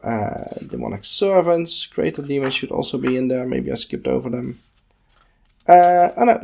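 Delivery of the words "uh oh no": sudden surprise. Real, not acted. Realizes he skipped a section.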